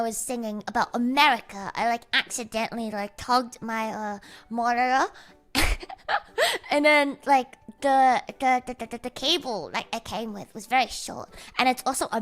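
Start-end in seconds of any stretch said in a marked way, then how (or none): 5.5-6.7 high laugh. Real, not acted. Laugh after story.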